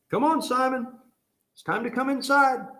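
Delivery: high singsong pet call; imitated high-voiced call to a pet; calling a dog. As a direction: coax a dog indoors, pitched high.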